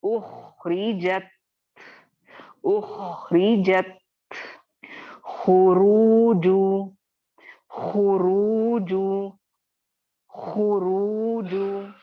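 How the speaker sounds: उखरिजत and खुरूजु drawn slow with a thick, rounded ख; second-level heavy ख in खुरूजु; heavy reading. Shown as a demonstration. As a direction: model each word slowly for repetition. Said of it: "कैसे पढ़ेंगे?".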